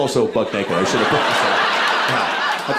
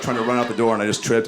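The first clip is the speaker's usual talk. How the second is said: usual speaking voice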